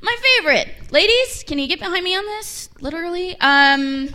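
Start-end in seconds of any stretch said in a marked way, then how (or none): 0.0-2.7 mock indignant. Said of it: "And I'm like".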